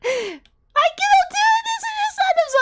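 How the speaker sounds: laugh-yell in a panic; panicked laughing shout. Real, not acted. Panicked laugh during scare.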